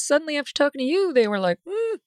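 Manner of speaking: jumps high, mimicking a sudden backtrack; mock innocent. Host mimicking virologists who reversed opinions, mock innocent. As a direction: imitate experts coyly changing their tune. Mocking virologists changing their story.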